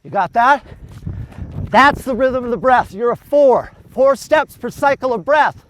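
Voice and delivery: loud coaching call over the run. Real, not acted. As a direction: call it out so the runner hears. Coach calling out over running.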